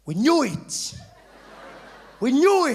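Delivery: loud, high laughing cheer; friends cheering. Retold or imitated. Friends welcoming him back, loud laughing cheer. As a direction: crow it out together. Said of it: "They're like".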